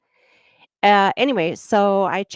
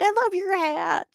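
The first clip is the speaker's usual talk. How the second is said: much higher, gushing back; excited; 12 semitones higher than usual. Retold or imitated. She returns the compliment to the red-hat guy, gushing high.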